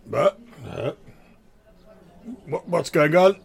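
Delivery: lightly voiced sleepy sword; a hint of a talking sword; ringing metallic sword voice. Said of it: "the sword's voice being like".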